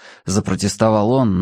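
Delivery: ordinary delivery, nothing marked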